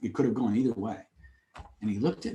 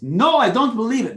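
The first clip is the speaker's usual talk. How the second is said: insistent denial; 8 semitones higher, 6 dB louder than usual. Retold or imitated. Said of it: "He goes".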